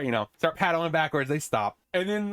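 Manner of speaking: ordinary delivery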